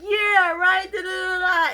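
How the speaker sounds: high, loud mocking blah-blah; mocking chatter. Mocking the date chatting with the waitress, high and loud. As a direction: mimic his dismissive yammering.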